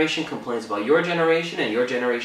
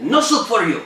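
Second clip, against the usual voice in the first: high, loud put-on character voice; sitcom catchphrase; 10 semitones higher, 6 dB louder than usual. Host quoting Seinfeld to co-host, high loud character impression. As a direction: proclaim it like a TV catchphrase, pitched way up.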